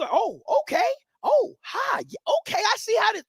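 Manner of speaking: high, rising hopeful fan voice. Retold or imitated. Fan watching the horror movie, high and hopeful. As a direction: play the fan getting their hopes up, up high.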